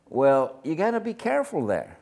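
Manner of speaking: normal talking voice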